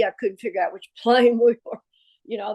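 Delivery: plain reading voice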